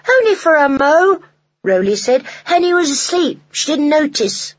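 put-on a child owning up cheekily; small boy Rowley. A narrator's reading. Rowley answering his father in a high child voice.

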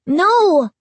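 high frightened yelp; scared cry. A narrator's reading. Brother protests in fear.

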